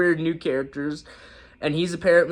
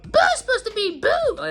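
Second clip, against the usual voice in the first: high Buu squeak; 4 dB louder than usual. Retold or imitated. Evoking Buu's high dub voice.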